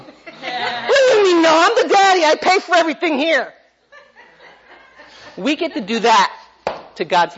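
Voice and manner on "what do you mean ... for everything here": high, laughing mock indignation; hammed-up indignant father; mock outraged dad. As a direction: play the put-upon daddy, half laughing. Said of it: "I go".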